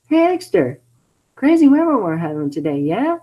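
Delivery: a hint of high nervous small talk; nervous Sonic. Sonic nervously changing the subject, slightly high. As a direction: chatter nervously to dodge her.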